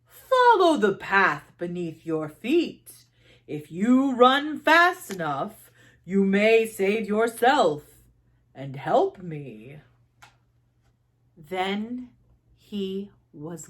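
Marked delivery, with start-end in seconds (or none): 0.0-9.8 put-on slow, weighty old man's counsel; the thunder grandfather giving instructions; strong old man. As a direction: guide her gravely, unhurried. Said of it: "the old man said".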